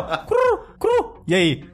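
high squawky cartoon bird voice; crow voice. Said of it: "ele pergunta assim, ó".

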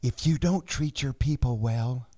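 slow hush sinking into a whisper; secret whisper. Acted. Sharing the business secret with the host, hushed whisper. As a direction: let the secret drop to a whisper.

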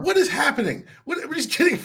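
high-pitched, cracking up mid-question; laughing disbelief. Real, not acted. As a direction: dissolve into real laughter, unable to finish. Co-host cracks up at the tangent.

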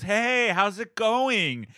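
stranger's friendly greeting. Retold or imitated. Stranger greeting him on his run, slow cheery hello. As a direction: greet a friend you think you know, slowly. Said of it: "looks at me and goes".

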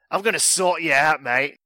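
matey, put-on Australian threat; bad Australian accent. Host relishing the email's line, bad Australian accent. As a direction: play up the broad Australian vowels. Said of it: "Maybe I'll do my Battle Strand accent".

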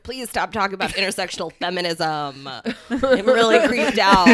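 laughing through a mock complaint; giggling, loud at the end. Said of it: "they're mostly just like".

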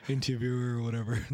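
ordinary delivery

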